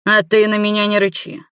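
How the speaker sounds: testy, defensive comeback; snapping back. A narrator's reading. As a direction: snap back at an accuser. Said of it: "огрызнулся врач".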